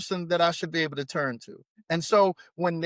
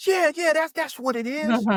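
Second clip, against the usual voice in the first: eager agreement; 10 semitones higher than usual. Retold or imitated. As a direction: burst out agreeing, relieved to be understood. Couple eagerly agrees, voiced by counselor.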